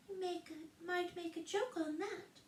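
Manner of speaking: very high, tiny gnat voice; little voice. A narrator's reading. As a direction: pipe it right by her ear. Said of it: "said the little voice close to her ear".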